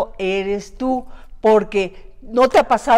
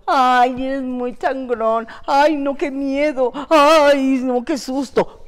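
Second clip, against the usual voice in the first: higher, slow, whimpering mock fear; mock frightened whine; 6 semitones higher, 5 dB louder, slower than usual. Cohost mimicking people scared of a strong character, mock whimper. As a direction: play the scared critic, half crying. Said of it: "le han dicho".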